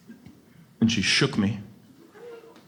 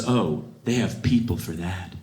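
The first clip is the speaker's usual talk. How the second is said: lower, slow entitled brush-off; snooty dismissal; 5 semitones lower, slower than usual. Retold or imitated. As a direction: wave it away like a snob. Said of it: "She says".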